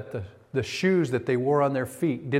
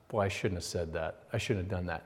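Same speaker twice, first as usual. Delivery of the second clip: regretful; 6 semitones lower, 8 dB quieter than usual. Retold or imitated. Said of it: "not to make promises when I'm angry".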